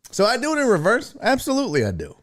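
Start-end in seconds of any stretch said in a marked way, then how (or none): none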